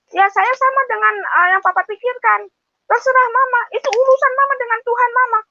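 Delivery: put-on high voice for her child's answer; imitating her child. Mother quoting her child's cold reply in a high voice. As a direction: lift the voice to play her child shrugging it off. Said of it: "Anak saya malah, malah bilang gini".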